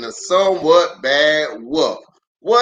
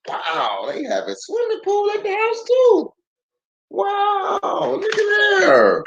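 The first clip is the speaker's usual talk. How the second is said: much higher, fast, squealing awe; excited little pig; 14 semitones higher than usual. Acted. Little pig marvelling at the swimming pool. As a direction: gasp with childish wonder.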